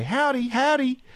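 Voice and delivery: high sing-song greeting to a dog; cheery howdy. Retold or imitated. Host reading a listener's greeting to a dog, high sing-song. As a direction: chirp it at the neighbour's dog. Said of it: "of course I say".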